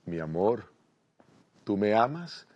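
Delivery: low, tender murmur in her ear. Acted. Husband hugging wife from behind, tender. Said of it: "le dice al oído con ternura".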